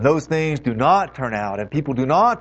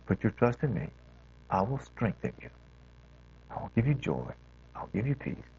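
tender God voice; 5 semitones lower, 7 dB quieter than usual. Retold or imitated. God's voice, tender reassurance.

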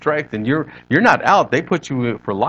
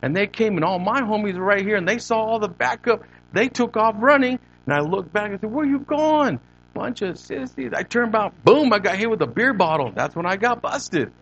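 higher, lighter East L.A. homeboy voice; pitched-up East L.A. street storyteller; East L.A. accent; 7 semitones higher than usual. Retold or imitated. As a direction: imitate an East L.A. homeboy excitedly recalling a fight. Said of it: "He goes".

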